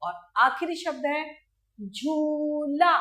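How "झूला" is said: झूला said very slowly with a long ऊ; झूला, slow, long ऊ held; long oo in jhoola. Shown as a demonstration. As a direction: stretch the ऊ of झूला for the class. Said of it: "इसका उच्चारण कैसे करेंगे".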